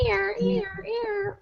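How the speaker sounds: high, soft mock alarm; alarm siren imitation. Red flag alarm noise about a pregnancy, high mock siren. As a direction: whine like a warning siren. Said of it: "I was like".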